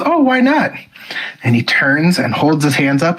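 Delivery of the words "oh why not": high, sing-song coaxing mother; mock-sweet mom asking why not. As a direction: coax him like a sweet mom. Mom coaxing son about Santa.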